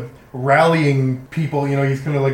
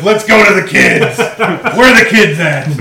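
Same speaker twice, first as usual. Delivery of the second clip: loud mock-eager quote, laughing; mock eagerness; 4 semitones higher, 9 dB louder than usual. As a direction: tease them with an over-keen imitation. Mocking the players' first thought.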